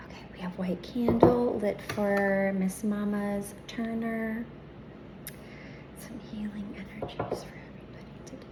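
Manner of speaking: soft ritual whisper. Real, not acted. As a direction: breathe it out softly.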